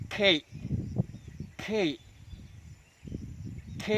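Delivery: can't cut short, the T stopped unreleased; held final t. Shown as a demonstration. Teacher showing the second version, can't with its T held. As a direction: hold back the T at the end of can't. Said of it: "huruf T-nya ditahan".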